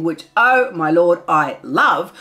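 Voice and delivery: ordinary talk, nothing marked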